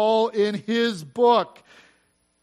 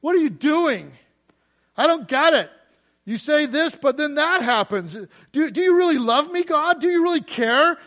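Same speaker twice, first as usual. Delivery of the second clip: high, insistent plea to God; doubting, frustrated questioning; 7 semitones higher, 5 dB louder than usual. Acted. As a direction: demand an answer, raised and pressing. Acted doubting questions thrown at God.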